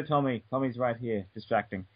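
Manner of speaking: ordinary talk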